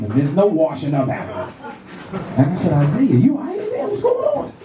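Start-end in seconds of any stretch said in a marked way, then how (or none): 2.7-4.5 calling out. Retold or imitated. Dad calling son in shower.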